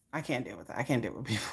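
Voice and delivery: low muttered aside; quiet. Real, not acted. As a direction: drop way down and soft. Overwhelmed aside.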